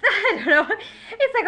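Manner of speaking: high, loud laugh into the words; a real laughing I don't know. Real, not acted. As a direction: laugh it off, can't keep count. Laughs at losing count of months.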